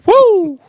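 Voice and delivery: very high, loud "woo" cheer; excited whoop. Real, not acted. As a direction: whoop like a hype man. Hyped whoop before story.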